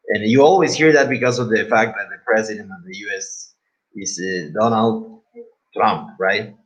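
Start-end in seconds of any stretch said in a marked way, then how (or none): none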